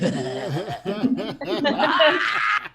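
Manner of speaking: rising creature wail while chasing; long wordless noise climbing high; Slimer. Retold or imitated. Comedian imitates chasing his girlfriend as a slime ghost, rising creature wail. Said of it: "I looked more like Slimer from Ghostbusters".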